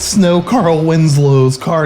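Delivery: ordinary talk, nothing marked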